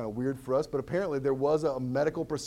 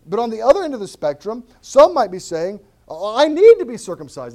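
much louder, higher preaching cry; 8 semitones higher, 14 dB louder than usual. Acted. Pastor crying out to the congregation, much louder. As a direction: belt it out from the pulpit.

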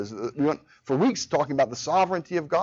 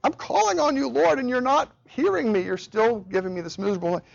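high, pleading complaint to the Lord; anguished prayer; 9 semitones higher than usual. Retold or imitated. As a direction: cry out to God in misery, half-imitated. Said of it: "I was".

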